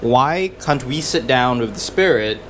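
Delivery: ordinary talk, nothing marked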